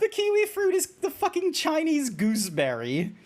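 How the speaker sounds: high, laughing outburst of disbelief. Real, not acted. Streamer tells viewers the kiwi is Chinese, laughing in disbelief. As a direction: crack up as the truth sinks in.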